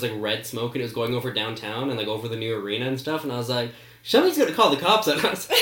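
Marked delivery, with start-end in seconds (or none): 4.0-5.5 fast, high, giggling alarm; half laughing at the trouble coming; laughing worry. Retold or imitated. Filming with smoke bombs, giggling that the cops will come. Red smoke over downtown.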